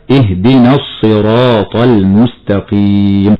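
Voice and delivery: slow, the lam merged into a doubled ص in الصراط; careful Quran recitation; tajweed recitation. Shown as a demonstration. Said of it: "فنقرأ هكذا".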